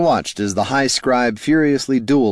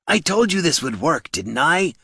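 prompting troll; 9 semitones higher than usual. A narrator's reading. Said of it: "prompted the troll".

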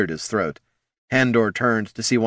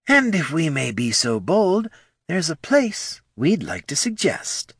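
higher, measured negotiator; smooth businessman voice; 8 semitones higher than usual. Acted. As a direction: lay out the plan like a sly banker. Bags suggests boldly.

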